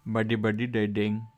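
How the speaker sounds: caricatured Indian accent, projected. Shown as a demonstration. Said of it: "I can do a great Indian accent".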